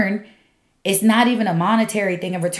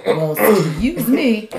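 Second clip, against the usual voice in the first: laughing; much slower than usual. Real, not acted. Flustered joke, laughing.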